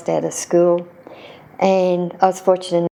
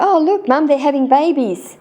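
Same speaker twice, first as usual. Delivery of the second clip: put-on higher, delighted little kid pointing something out; excited child; 7 semitones higher than usual. Young boy telling his mum the fish are being born, excited. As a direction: bubble over like a kid who has just worked it out. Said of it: "And I said".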